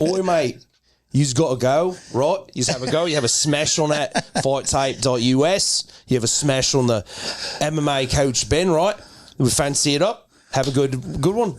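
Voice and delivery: broad Aussie "mate" voice; put-on Australian accent. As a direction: plug it like a matey Australian. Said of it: "You want another accent?".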